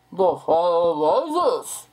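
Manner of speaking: the cliché Bane impression, slow and loud. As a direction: boom the title out like Bane. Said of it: "they have to do a Bane impression".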